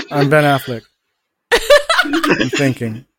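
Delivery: slow slack-jawed impression, mouth slightly ajar; Ben Affleck. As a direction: play him vacant, mouth hanging open, amused. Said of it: "always has his mouth slightly ajar".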